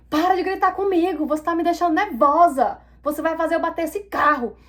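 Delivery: high-pitched, indignant telling-off; heated, acted-out protest; retold angry retort. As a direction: re-enact snapping back at a yelling passenger. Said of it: "eu falei desse jeito com ela".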